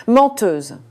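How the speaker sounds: loud accusing shout; ringing accusation. A narrator's reading. Diner accusing the waitress of lying, ringing shout. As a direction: hurl the word so it echoes. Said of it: "La voix de Victor résonna".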